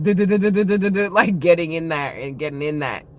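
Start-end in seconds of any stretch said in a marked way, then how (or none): 0.0-1.4 jackhammer noise. Retold or imitated. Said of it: "like, with jackhammers".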